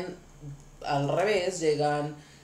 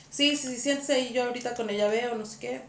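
put-on higher, quick norteño accent; Tijuana accent; 4 semitones higher, faster than usual. Stylist talking to the husband in her Tijuana voice. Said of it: "mi voz tijuanita".

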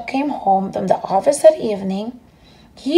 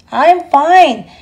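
put-on boy calling back; 5 semitones higher, 6 dB louder than usual. Stanley answering from mailbox.

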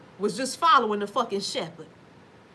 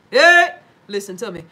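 loud call; 11 dB louder than usual. Real, not acted. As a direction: shout hey to grab them. Calling out to viewers.

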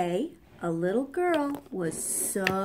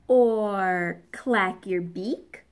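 ordinary delivery

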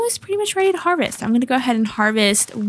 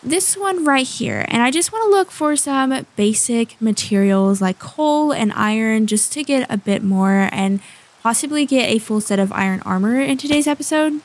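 ordinary talk

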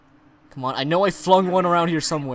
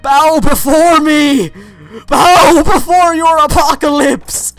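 dark lord; 5 semitones higher, 6 dB louder than usual. Pretend. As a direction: proclaim like a doomsday tyrant. Villain boast in game.

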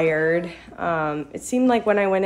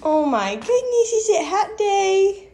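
mock amazement; 11 semitones higher than usual. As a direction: pretend to be amazed. Playful surprise to child.

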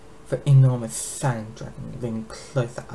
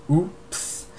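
higher, fake-innocent oops; mock oops; 6 semitones higher than usual. Dragon queen fakes dropping the egg, mock innocent. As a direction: pretend it slipped, teasing.

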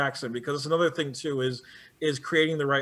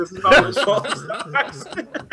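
mock solemn apology; 6 semitones higher, 7 dB louder than usual. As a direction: draw out a fake earnest apology while laughing. Joining mock apology to Africa.

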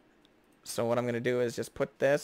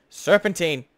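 "Serpentine" called out louder, falling; 3 semitones higher, 8 dB louder than usual. Shown as a demonstration. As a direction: shout "Serpentine" as an order.

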